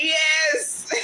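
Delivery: high, loud crying yes; tearful yes. Retold or imitated. Mother answering husband at the birth, crying out yes. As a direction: cry out yes in triumph. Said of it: "I was like".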